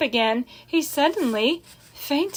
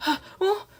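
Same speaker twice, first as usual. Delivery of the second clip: higher, whimpering excuse; timid farmhand defending himself; Lurvy answering meekly, near tears; 10 semitones higher than usual. A narrator's reading. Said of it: "said Lurvy meekly".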